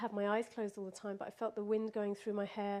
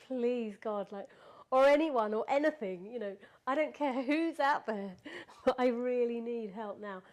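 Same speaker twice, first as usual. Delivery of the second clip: louder, pleading appeal to anyone listening; retold desperate prayer; 6 dB louder than usual. Robbery victim praying to God for help, retold urgent plea. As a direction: plead aloud, half laughing at the memory. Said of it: "I prayed in Spanish, and I just said".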